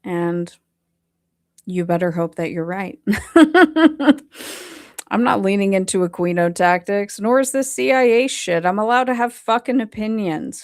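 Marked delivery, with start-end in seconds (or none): 3.0-5.0 loud, high burst of laughter; real high-pitched laugh. Real, not acted. Breaks into laughter at doubters.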